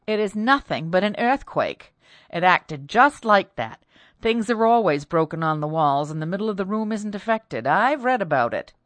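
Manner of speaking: loud, firm scolding man; stern husband. Acted. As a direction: take charge, firm and loud.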